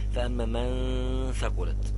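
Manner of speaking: فأما من ثقلت recited slowly, the noon held two beats; demo recitation. Shown as a demonstration. Teacher demonstrating to students, slow recitation. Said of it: "ومدها حركتين".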